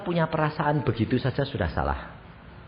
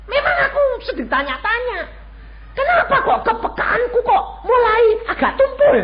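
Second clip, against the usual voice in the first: much higher, laughing, puzzled question; higher, puzzled self; 9 dB louder than usual. Retold or imitated. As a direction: play his younger self, baffled and half laughing. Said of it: "saya bilang".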